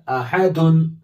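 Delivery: अहदुन with the full दुन ending. Shown as a demonstration. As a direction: say अहदुन with tanween. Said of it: "दुन, क्या बनेगा?". (अहदुन पूरा)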